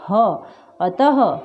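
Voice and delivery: अतः said slowly with a breathy ह at the end; visarga demo. Shown as a demonstration. Teacher slowly showing how the visarga sounds. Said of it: "इसका उच्चारण क्या होता है".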